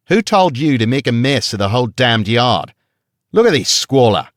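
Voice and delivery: guard berating the woman over a stone in the yard; angry berating. A narrator's reading. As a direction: rage at the mess in an Australian accent.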